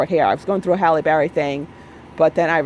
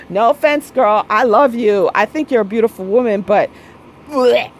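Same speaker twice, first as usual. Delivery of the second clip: quoted friend, sassy; 3 semitones higher, 4 dB louder than usual. Retold or imitated. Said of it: "they've said that to me".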